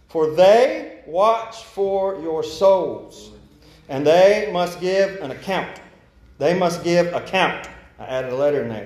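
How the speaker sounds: slow, deliberate scripture reading; slow reading again. Shown as a demonstration. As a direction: let each phrase land slowly. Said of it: "One more time".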